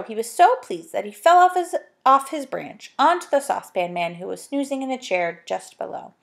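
plain reading voice

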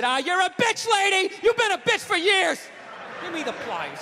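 hammed-up angry dad snapping at his wife; shouting husband mid-repair, barking at the wife; dad yelling.

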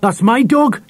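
put-on high-pitched boyish protest; yelled. A narrator's reading. Harry claims his dog before Dulcie, yelling. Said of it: "yelled Harry".

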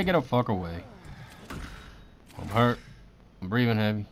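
ordinary talk

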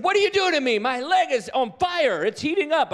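high, loud panicked yelp; retold alarm, high and loud; mock panicked cry. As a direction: play the man startled by heat in his leg. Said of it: "He said".

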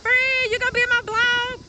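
high-pitched call across the street. Real, not acted. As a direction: holler to a friend some way off. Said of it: "yelling across the street".

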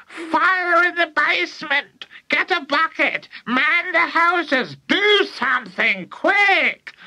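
put-on high, screeching old-woman panic; yelling. A narrator's reading. Grandma calling for help, yelling frantically. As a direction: screech drawn-out orders at very high pitch. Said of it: "the old woman yelled".

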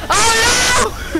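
high, loud wail of alarm; loud cry. Real, not acted. Player reacts to the game going wrong, loud cry.

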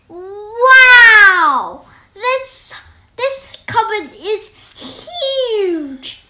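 high, slow, awed wonder with a laugh; amazed. Acted. Jack amazed inside the giant's cupboard. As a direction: marvel at the huge space.

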